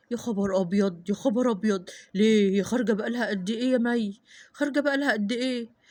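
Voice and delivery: put-on dad shocked the girl is late; alarmed father. Father alarmed daughter missing. (أب مخضوض بصوت تخين)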